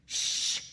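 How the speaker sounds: a sharp, loud shushing hiss; urgent shush. A narrator's reading. Chloe shushes Val so nobody overhears, sharp shush. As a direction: hush her at once.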